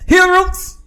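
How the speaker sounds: high, loud opening shout; hailing shout. Pretend. Host hailing listeners at the podcast's start, loud high shout. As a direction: shout it out to open the show.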